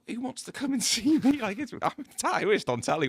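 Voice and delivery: incredulous chuckle, who'd want me?; laughing disbelief. Retold or imitated. Retold doubt about the nightclub gig.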